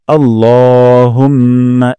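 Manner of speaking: "আল্লাহুম্মা" with the ল held one alif long; slow, draw out the ল; lengthened la in Allahumma. Shown as a demonstration. Teacher demonstrating the long ল to students. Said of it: "ল এক আলিফ টান দিব".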